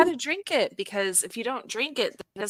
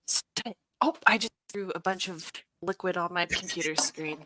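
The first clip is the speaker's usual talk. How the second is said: usual speaking voice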